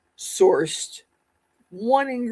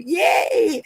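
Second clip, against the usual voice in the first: mock cheer; 11 semitones higher than usual. Celebrating joke about [name].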